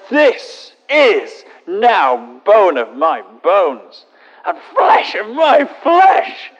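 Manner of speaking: Adam hamming up his delight, slowing then crying out; mock rapturous Adam. Adam greeting the woman, hammed-up joyful cry. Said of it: "I'm sure he said it like that".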